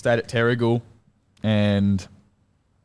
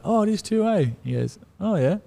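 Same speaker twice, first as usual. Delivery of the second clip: much higher retold coach's voice; mock realisation, pitched way up; mimicked coach; 12 semitones higher than usual. Player mimics coach realising the game's in his hometown, mock surprise.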